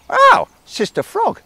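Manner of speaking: high slow crow call. Acted. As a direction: squawk it like a crow. Crow addresses Frog.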